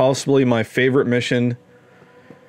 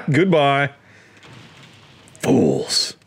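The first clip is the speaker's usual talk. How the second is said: mock villain taunt; slower than usual. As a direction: sneer Fools like a cartoon baddie. Taunting dead enemies as villain.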